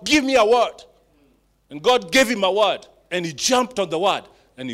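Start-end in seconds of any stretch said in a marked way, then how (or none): 0.0-0.8 loud, high daring cry; bold demand. Acted. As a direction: shout the challenge upward. Peter daring God, quoted.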